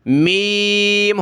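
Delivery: very slow drawn-out letter names; letter drill recital; slow recitation. Shown as a demonstration. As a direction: chant each letter.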